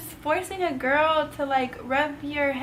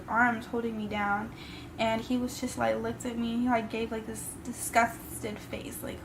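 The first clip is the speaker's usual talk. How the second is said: ordinary delivery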